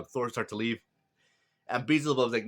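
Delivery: plain reading voice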